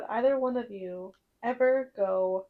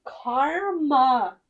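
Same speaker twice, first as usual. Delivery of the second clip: teasing taunt; 3 semitones higher, 7 dB louder, much slower than usual. Real, not acted. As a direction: stretch both syllables into a loud taunt. Teases friend who hurt her hand.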